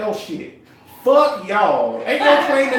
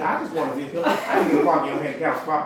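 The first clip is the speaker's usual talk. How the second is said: ordinary talk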